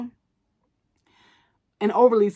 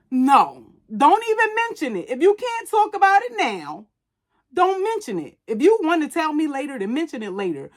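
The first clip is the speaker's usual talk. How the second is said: very high-pitched, insistent protest; exasperated rant; 7 semitones higher than usual. Acted. Overthinker scolding people who say "we need to talk", exasperated.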